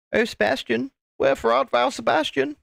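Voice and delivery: high, hammed-up swoon from a lovesick fan; mock swooning lover. Teasing a viewer about Sebastian, high mock swoon. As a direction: sigh it out like Juliet calling from a balcony. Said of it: "I'm just joking".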